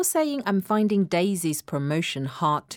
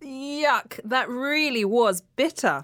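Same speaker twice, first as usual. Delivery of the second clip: higher, exclaimed distaste; disgusted; 4 semitones higher, slower than usual. Acted. Co-host has just swallowed bitter tablets, disgusted. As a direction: recoil from a bitter taste.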